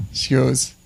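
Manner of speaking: ordinary delivery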